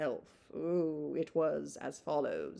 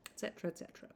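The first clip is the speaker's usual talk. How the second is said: soft unvoiced tail; breathy dismissive whisper; whispered trail-off; 2 semitones higher, 9 dB quieter, much faster than usual. Shown as a demonstration. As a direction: whisper the et cetera away. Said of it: "I love finishing a letter like that".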